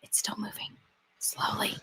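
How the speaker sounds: hushed whisper, almost no voice. Real, not acted. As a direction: breathe the words as a secret. Watching slow elevator, hushed.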